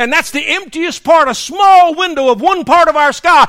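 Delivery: loud, high, ringing pulpit shout; impassioned preacher at full cry; full-force preaching. Real, not acted. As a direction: thunder it out to the whole congregation.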